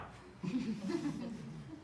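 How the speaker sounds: a short high laugh at his own trick; amused laugh. Real, not acted. Speaker laughing at his own scare tactic, high laugh.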